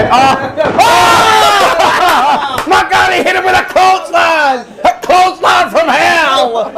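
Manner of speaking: high excited shouting; wrestling commentator. Real, not acted. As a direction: call the big hit like a ringside announcer. Watching wrestling, excited calling out.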